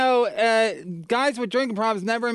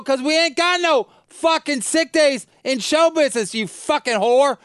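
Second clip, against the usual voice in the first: high, loud angry yell; 8 semitones higher, 4 dB louder than usual. Retold or imitated. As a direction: snap back at the wife with a yell. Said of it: "when I yell like that".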